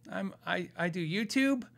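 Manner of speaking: soft, halting, questioning answer; hesitant admission. Retold or imitated. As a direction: stumble into it, unsure of your answer. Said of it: "I had trouble with this".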